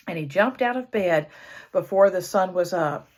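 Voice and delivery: plain reading voice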